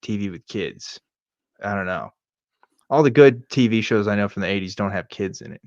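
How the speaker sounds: ordinary talk, nothing marked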